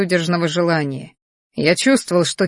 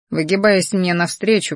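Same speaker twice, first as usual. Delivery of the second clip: ordinary delivery, nothing marked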